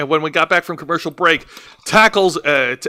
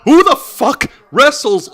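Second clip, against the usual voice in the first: incredulous; 8 semitones higher, 4 dB louder, slower than usual. Real, not acted.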